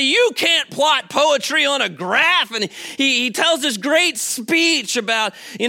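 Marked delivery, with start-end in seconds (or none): 0.0-2.5 retold teacher's protest. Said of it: "he goes on to say".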